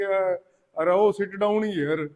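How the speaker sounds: slow, lightly put-on local tone on English; a hint of village Hindi twang in English; mock desi English accent. Teacher imitating an Indian speaking English, lightly accented. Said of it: "थोड़ी सी tone अपने वाली आ जाए".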